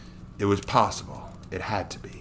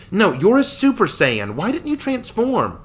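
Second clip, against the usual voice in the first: much higher and louder, slow, demanding; insistent girl voice; 13 semitones higher, 7 dB louder than usual. Acted. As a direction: press him for an answer. Victoria presses the hero.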